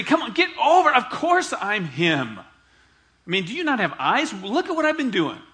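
loud, high scolding; mock impatient telling-off; rebuking Jesus. Jesus scolding John's disciples, mock impatient and loud. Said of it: "He didn't say".

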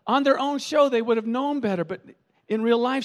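normal talking voice

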